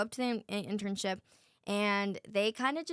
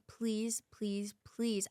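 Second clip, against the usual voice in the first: whisper; 4 dB quieter, much slower than usual. Retold or imitated. Pleading for the internship.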